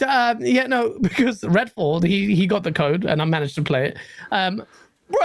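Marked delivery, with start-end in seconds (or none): none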